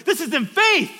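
loud, high preacher's shout; forceful exhortation. Real, not acted. Preacher answers congregation's doubts, forceful shout. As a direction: punch it out at full voice.

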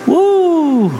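high, playful woo as feathers fly; a hammed-up cheer for the scattering; mock whoosh. Pastor scattering feathers, a high playful woo.

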